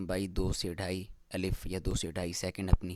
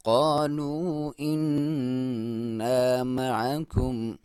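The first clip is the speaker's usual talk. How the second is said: slow, drawn-out tajweed chant; slow Quran recitation; 4 semitones higher, 4 dB louder, much slower than usual. Shown as a demonstration. Teacher reciting the verse for students, slow and drawn out. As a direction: recite it slowly, stretching each vowel. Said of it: "तो आप इसको फिर ऐसे पढ़ेंगे".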